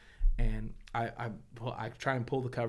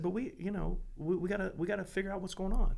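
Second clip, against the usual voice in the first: gentle coaxing; 8 semitones higher than usual. Retold or imitated. Dad gently coaxing his son to explain. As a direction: coax him to open up, tenderly.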